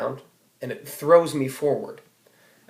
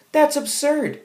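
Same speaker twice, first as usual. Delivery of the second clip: outraged; 5 dB louder than usual. Real, not acted. Outraged verdict on the scene.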